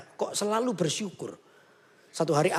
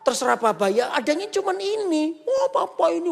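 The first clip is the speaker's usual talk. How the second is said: whiny complaint; 11 semitones higher than usual. Acted. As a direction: complain like a spoiled kid. Complaining child grumbles.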